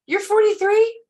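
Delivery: high, loud shocked squeal; can't believe she's forty-three; shocked disbelief. Retold or imitated. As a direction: gasp it out in disbelief. Women react to her age.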